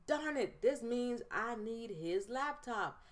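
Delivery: high-pitched, drawn-out mock dismay; hammed-up frustration, pitched high; retold exasperation. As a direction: act out grudging realization, slowing down. Retells realizing she must back down.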